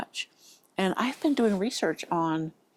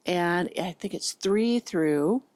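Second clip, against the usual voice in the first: normal talking voice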